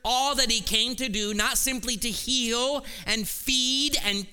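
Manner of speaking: fervent pulpit preaching; preaching at full force. Real, not acted. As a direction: drive it home to the congregation. Preaching the climax of sermon.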